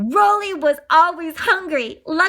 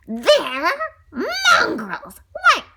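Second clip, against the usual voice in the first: disgusted cry; 11 semitones higher, 4 dB louder, much slower than usual. Acted. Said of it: "she cried out".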